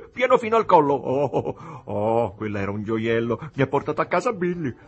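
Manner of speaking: loud, high-pitched tipsy-night brag; nervous boasting. A narrator's reading. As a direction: crow about it with nervous excitement. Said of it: "si vantò Jim con una risatina nervosa". (vanto sguaiato e nervoso)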